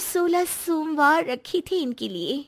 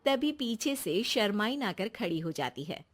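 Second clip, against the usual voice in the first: lower, softer narrator voice that fades away; story narration; 6 semitones lower, 8 dB quieter than usual. A narrator's reading. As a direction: tell it like a storyteller, dropping at the end. Narrator sets scene. (कहानी सुनाने वाली, नीची और धीमी आवाज़)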